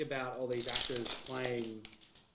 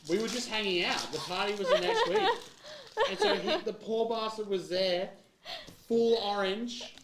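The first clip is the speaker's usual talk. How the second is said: excited laughing; 7 semitones higher, 7 dB louder than usual. Real, not acted. Excited funny retelling.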